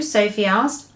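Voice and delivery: ordinary delivery, nothing marked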